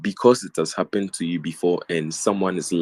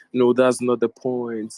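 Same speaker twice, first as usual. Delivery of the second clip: mocking classmate; 5 semitones higher, 5 dB louder than usual. Classmate quoted defensively.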